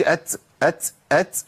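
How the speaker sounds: أت said as "ats"; wrong seen after ta. Shown as a demonstration. Teacher showing the error before rejecting it, ت plus س. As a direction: finish أت with a hissed س. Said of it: "ده خطأ".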